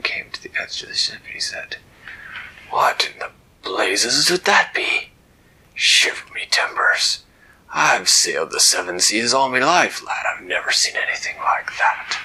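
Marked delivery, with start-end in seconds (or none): 2.7-12.2 loud salty pirate captain voice; an old sea dog amazed. Acted. Captain staring down at the lights, loud pirate voice.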